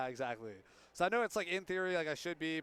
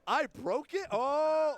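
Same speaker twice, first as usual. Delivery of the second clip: panicked surprise; 9 semitones higher, 5 dB louder, much slower than usual. Real, not acted. Board setup fails.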